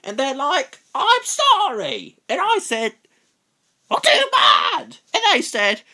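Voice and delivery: put-on very high, loud chatty girl; excited kid rambling on; little girl voice. Child voice in sound clip.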